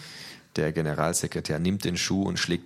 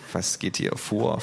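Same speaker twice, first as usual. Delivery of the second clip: ordinary delivery, nothing marked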